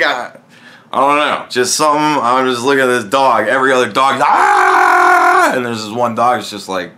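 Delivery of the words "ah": a loud low bark of a yelp; mock dog bark; barking dog imitation. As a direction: bark like a noisy pound dog. Imitating the other barking dogs.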